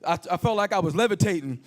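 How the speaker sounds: ordinary delivery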